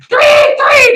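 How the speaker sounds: very high, loud shrill tweet of a police whistle; whistle blast imitation. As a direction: shout it like a whistle going off. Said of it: "blow the whistle".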